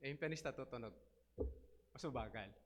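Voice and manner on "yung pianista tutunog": ordinary delivery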